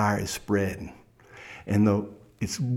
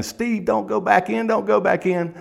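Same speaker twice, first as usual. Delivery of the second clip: much higher pleading shout; crowd yelling; 12 semitones higher than usual. Retold or imitated. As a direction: beg him from the crowd not to go back. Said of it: "they're yelling".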